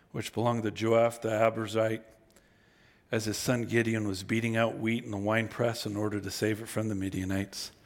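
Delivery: low, measured Bible reading voice; scripture reading. Acted. Preacher reading Gideon's story aloud to the congregation, low and measured.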